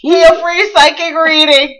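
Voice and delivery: loud, high hammed-up Jamaican psychic hotline pitch; Miss Cleo imitation. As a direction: sell the free psychic reading in a mock Jamaican accent. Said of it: "More like Miss Cleo".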